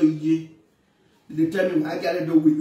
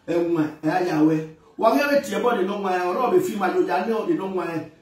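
ordinary delivery

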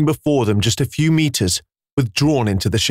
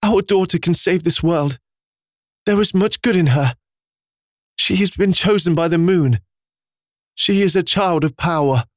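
crying; 7 semitones higher than usual. A narrator's reading. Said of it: "in between crying and short breaths".

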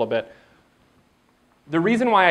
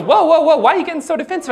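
much higher, innocent mom; mock mom voice; 12 semitones higher, 3 dB louder, faster than usual. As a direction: play mom calming her son. Voicing mom.

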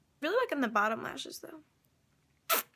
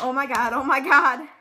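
rising to a loud joyful cry; relieved excitement; 8 dB louder than usual. Real, not acted. Vlogger reacting as the new laptop case fits, loud relief. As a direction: build from relief to a loud cheer.

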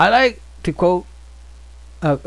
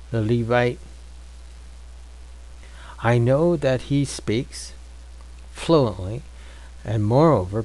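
slow, measured Bible reading; scripture reading; 3 semitones lower, 3 dB quieter, slower than usual. Acted. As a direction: read the verses slowly and solemnly.

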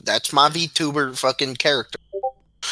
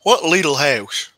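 put-on southern accent; 3 semitones lower, slower than usual. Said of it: "your southern accent is pretty terrible".

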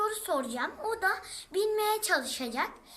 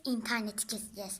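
ordinary talk

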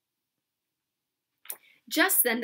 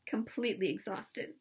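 plain reading voice

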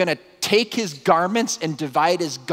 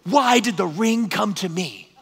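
slow, raised voice quoting a film; movie-scene quote; 6 semitones higher than usual. Acted. Professor answering a student with his favorite scene, movie-quote voice. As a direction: drag out a dramatic movie line. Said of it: "What's your favorite scene from a movie?".

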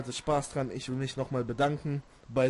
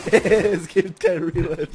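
laughing; 3 semitones higher, 10 dB louder, slower than usual. Real, not acted. Laughing repeating friend's line.

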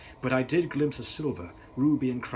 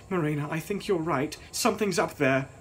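put-on frightened girl; 6 semitones higher, 4 dB louder than usual. A narrator's reading. Gladys voiced, frightened.

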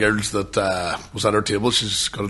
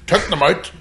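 excited; 13 semitones higher, 5 dB louder than usual. Retold or imitated. Retold prank, building excitement.

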